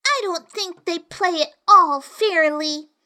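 put-on slow, high whiny girl; complaining tone. A narrator's reading. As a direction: complain like a sulky child. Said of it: "in rather a complaining tone".

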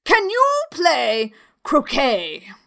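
imperious royal shout; shouted question. A narrator's reading. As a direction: demand it slowly at full voice. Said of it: "shouted the queen".

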